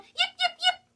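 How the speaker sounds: very high, loud little fox yip. Acted. Little fox calls out to the party, high yipping. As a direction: yap like a tiny fox calling out. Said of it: "She called".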